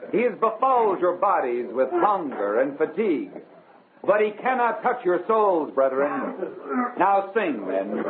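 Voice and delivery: a mission preacher exhorting his tired flock; rally the weary men to sing, gently fervent; mild sermon cadence; preaching. Acted.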